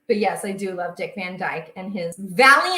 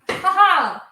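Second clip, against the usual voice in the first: high, loud gleeful ha ha; triumphant laugh; 9 semitones higher, 10 dB louder than usual. Real, not acted. Pleased with herself, a loud triumphant ha ha. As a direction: crow over the little win.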